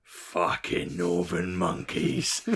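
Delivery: put-on very slow London geezer accent; drawn-out Southern London scorn. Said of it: "Southern London geezer".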